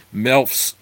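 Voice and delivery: usual speaking voice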